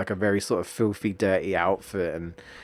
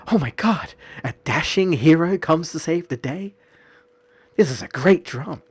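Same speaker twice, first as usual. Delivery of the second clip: much higher, gushing over a rescue scene; mock dramatic excitement; 7 semitones higher than usual. A fan gushing over a dashing hero rescue, much higher and hammed-up. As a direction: swoon like a soap opera fan. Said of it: "I'm just like".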